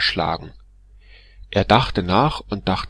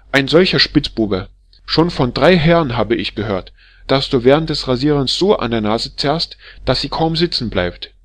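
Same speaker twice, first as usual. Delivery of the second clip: much louder and higher, raging tirade; furious scolding; 5 semitones higher, 7 dB louder than usual. Acted. Wife berating the barber as a drunken scoundrel, furious yelling. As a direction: tear into him like an outraged wife.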